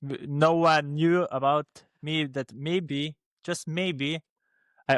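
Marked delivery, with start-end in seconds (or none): none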